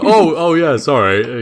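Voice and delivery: loud, laughing mock apology; laughing jailer. Jailer Dave laughs an apology to the prisoner. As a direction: chuckle through a sheepish sorry. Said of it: "he's like".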